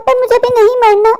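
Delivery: ordinary delivery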